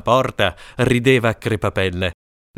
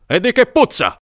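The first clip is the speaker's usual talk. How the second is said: much higher, louder irritated king; irritated shout; 13 semitones higher, 5 dB louder than usual. Acted. King irritated at Ferrazzano, loud shout. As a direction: snap at the courtier. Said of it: "gridò il re irritato".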